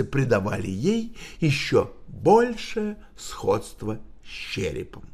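plain reading voice

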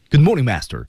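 Good morning master said fast with force; quick and forceful. Shown as a demonstration. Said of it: "它就是快".

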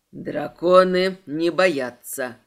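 low, loud, slow dragon pronouncement. A narrator's reading. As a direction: proclaim it slowly as a deep-voiced dragon. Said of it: "Его голос звучит в голове". (низко, веско и медленно, голосом дракона)

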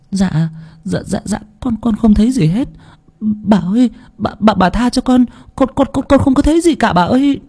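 high, stammering plea for mercy; begging in terror. Acted. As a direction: beg on your knees, stuttering. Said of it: "quỳ xuống van xin". (quỳ xuống van xin, lắp bắp sợ hãi)

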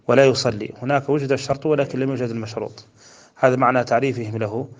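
usual speaking voice

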